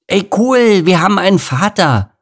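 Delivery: put-on loud, high boyish cheer; high-pitched, overexcited youngster; excited lion cub. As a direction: blurt it out like a thrilled kid. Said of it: "sagte Lukas".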